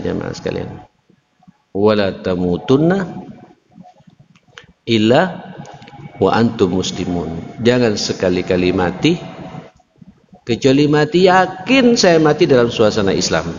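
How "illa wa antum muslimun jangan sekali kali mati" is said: normal talking voice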